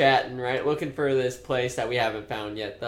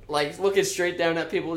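normal talking voice

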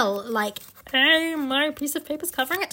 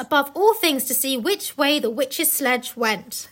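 slow, careful tongue twister; slower than usual. Shown as a demonstration. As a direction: say it slowly and carefully. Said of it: "try saying that".

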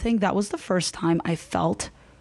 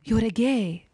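put-on Persian-accented accusation, insistent; shocked Persian mom; slower than usual. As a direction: imitate a stunned mother repeating herself in a Persian accent. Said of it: "Shock. She's like".

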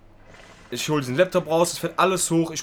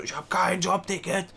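slow, higher mock outcry; mock yelling; 5 semitones higher, slower than usual. Clerk ranting about her job ticket, slow mock yell. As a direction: imitate a clerk yelling, held back in volume. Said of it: "die schreit so rum und sagt so".

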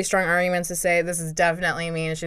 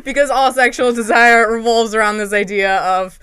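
louder, higher words through real laughter; laughing; 4 semitones higher, 9 dB louder than usual. Real, not acted. Host cracks up agreeing with the penis joke, laughing loudly. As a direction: laugh your way through the point.

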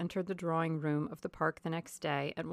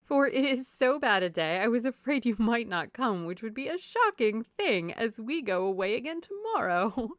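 chatty society lady, high and loud; silly, sociable wife making a fuss; effusive Mrs. Palmer; 5 semitones higher, 7 dB louder than usual. A narrator's reading. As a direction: gush away in a raised, emphatic voice.

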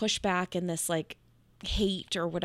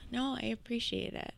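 breathless whisper through real tears; tearful whisper; 3 semitones higher, 7 dB quieter than usual. Real, not acted. Guest thanking host while in tears, tearful whisper. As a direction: barely get the words out while crying. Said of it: "The tears, like, just keep rolling".

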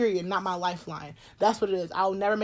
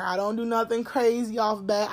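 usual speaking voice

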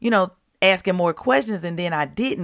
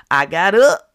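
I got up, said much louder; loud declaration; 11 dB louder than usual. Real, not acted. Telling listeners she rose from bed, loud and triumphant. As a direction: proclaim it with triumph.

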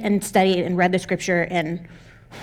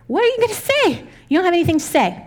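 high mocking taunt; 7 semitones higher than usual. Mocking the doubting voice.

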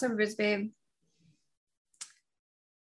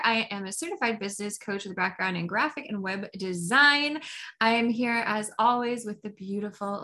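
podcast host intro; 4 dB louder than usual. Acted. Host opening the podcast for listeners, bright announcer read. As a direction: welcome listeners like a radio host.

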